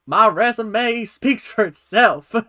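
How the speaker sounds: slow, high, loud Southern drawl; hammed-up Southern queen accent. Quoting the mom on her daughter's resume, mock Southern accent. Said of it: "doing the Southern accent".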